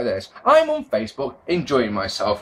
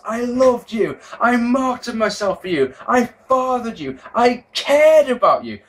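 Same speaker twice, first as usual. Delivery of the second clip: higher, slower mock wrestling-promo yell; imitating Heyman shouting; 8 semitones higher than usual. Retelling Heyman yelling at Punk, mock shout. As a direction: ham up a heartbroken manager. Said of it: "he shouts".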